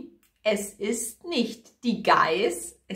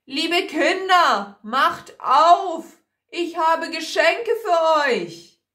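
loud, raised storybook call; 7 semitones higher, 6 dB louder than usual. A narrator's reading. Wolf calls to the kids to open up, calling loudly. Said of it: "Eine Stimme ruft".

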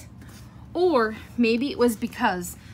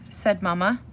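ordinary delivery, nothing marked

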